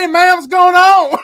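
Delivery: high, loud and slow country holler; imitating Bullfrog. Imitating Bullfrog answering the yard through the window, high, loud, slow holler. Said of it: "acting like I'm Bullfrog".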